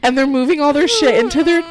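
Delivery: high voice failing mid-sentence; real tearful struggle to get words out; choked up. Real, not acted. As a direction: keep restarting as tears take over. Too overcome to finish sentence.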